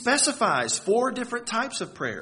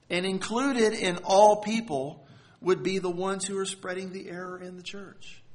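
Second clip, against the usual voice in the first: ordinary talk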